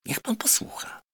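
hushed, secretive whisper; confiding whisper. Acted. Merchant whispering a secret to the doctor.

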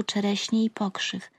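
plain reading voice

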